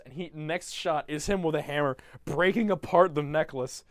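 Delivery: ordinary delivery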